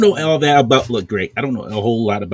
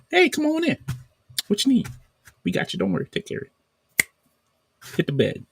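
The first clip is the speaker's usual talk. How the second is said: mock friendly nurse; 5 dB quieter than usual. Imitating welcoming Canadian hospital staff.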